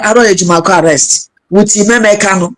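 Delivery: normal talking voice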